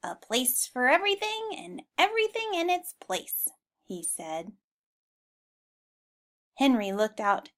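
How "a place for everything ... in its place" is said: lightly raised, prim piggy voice; a hint of a fussy pig; tidy pig voice. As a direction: say it like a neat little pig pleased with himself. Henry the tidy pig speaks.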